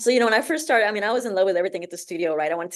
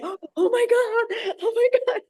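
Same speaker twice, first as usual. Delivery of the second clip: high, drawn-out squeal through laughter; laughing disbelief; 13 semitones higher, slower than usual. Retold or imitated. Retelling how she reacted to the accent contest, laughing disbelief. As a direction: relive the giddy shock, laughing as you speak.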